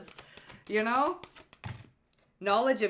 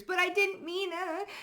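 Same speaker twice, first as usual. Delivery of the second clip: much higher, mock-whining excuse; whiny plea; 12 semitones higher than usual. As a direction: whine it like a caught culprit. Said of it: "People crying to the ancestors".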